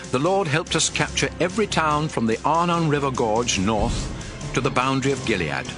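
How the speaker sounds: usual speaking voice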